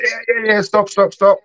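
high-pitched, urgent imitation of begging off; retold frantic plea. Novice grappler to training partner after being tied up, mock panicked.

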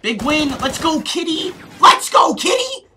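high, loud celebratory yelling; excited cheering. Real, not acted. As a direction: hype the team up loudly. Coach cheering a round win.

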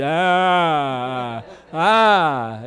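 long, wordless droning "ah"; speechless groan. Retold or imitated. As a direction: moan as if unable to talk. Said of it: "you lose the ability to talk".

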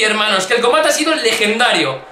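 normal talking voice

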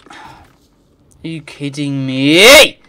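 drawn out very slow and loud; furious disbelief. Real, not acted. Gamer stretching out his outrage at a missed jump. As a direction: stretch every word in outrage.

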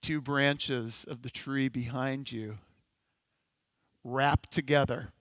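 hushed, contemplative guided imagery; guided meditation. Acted. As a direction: let each phrase settle into stillness. Guided imagination exercise continues.

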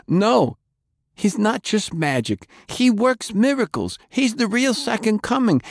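high, feverish zealot voice; fanatical prisoner. Acted. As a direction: preach the chairman like a true believer.

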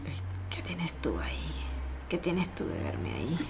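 tense whisper. Real, not acted. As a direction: whisper it nervously. Peering at camera tensely.

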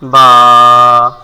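বা with a long, level, loud vowel; drawn-out বা for students; model letter pronunciation. Shown as a demonstration. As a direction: hold বা steady on one low note. Teacher models the Arabic letter.

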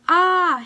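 loud high cry of pain; storybook scream at a sudden knock; high, loud "Ah!" yelp. A narrator's reading. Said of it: "Harriet screamed".

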